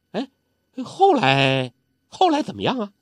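put-on curious old urchin pressing; Zhou Botong eager. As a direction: lean in hungry for the rest of the story.

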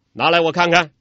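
loud, firm demand; bold demand. A narrator's reading. Yang Tiexin demands to see the warrant, loud firm demand. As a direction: demand the paper boldly.

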